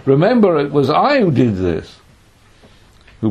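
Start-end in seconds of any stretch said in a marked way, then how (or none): 0.1-2.0 high, loud reminder with "I" stressed; emphatic I. Acted. God reminding Israel who saved them, "I" emphatic. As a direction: press home "Remember" and "I". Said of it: "I'm giving emphasis".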